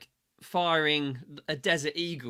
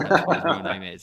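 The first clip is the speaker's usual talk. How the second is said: laughing; 2 semitones higher, 8 dB louder, faster than usual. Real, not acted. Laughing aside about fake name.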